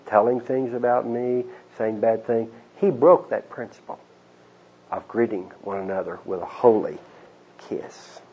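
ordinary delivery

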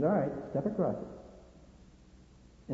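slow, quiet dare, trailing off softly; fighter's dare. Acted. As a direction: dare him softly, drawing the words out. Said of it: "he drew a line in the sand and he says".

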